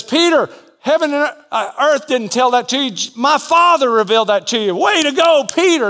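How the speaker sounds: Jesus congratulating Peter, high and upbeat; excited praise. Acted. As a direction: praise him like a proud coach.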